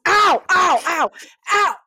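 high, loud imitated yelps of pain; pained cries. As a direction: cry out as if your hands were crushed. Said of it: "and he's in pain".

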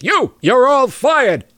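mock Brooklyn accent, high and pompous; pedantic Brooklyn accent. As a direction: play the fired Brooklyn hotshot, slow and pompous. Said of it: "got this pedantic Brooklyn accent".

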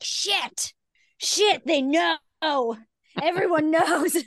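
high, loud outburst of dismay; mock panic. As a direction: cry it out high, as if found out. Acts out panic at being found out.

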